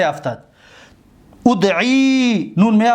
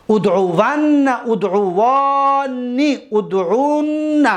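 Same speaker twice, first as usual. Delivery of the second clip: ادعوون ادعوانی ادعون stretched slowly, rising higher; slow drilled forms; 4 semitones higher, much slower than usual. Shown as a demonstration. Teacher to class reciting emphatic forms slowly. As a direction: draw each form out and hold the nun. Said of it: "پس میگیم".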